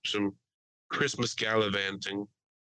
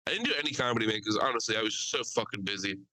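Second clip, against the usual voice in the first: ordinary talk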